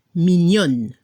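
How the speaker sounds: MIGNON closed on a clear n, silent e; low, finish MIGNON on the n; sounded final n. Shown as a demonstration. French teacher repeating the feminine, MIGNON with audible final n. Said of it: "Se escucha la N al final".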